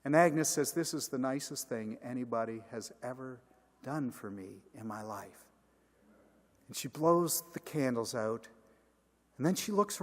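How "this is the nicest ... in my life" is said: tearful. Retold or imitated. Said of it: "Agnes is crying".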